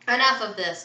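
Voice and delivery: loud impatient cut-in. A narrator's reading. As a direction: put your foot down impatiently. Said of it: "stepped forward impatiently".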